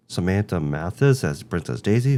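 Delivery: ordinary delivery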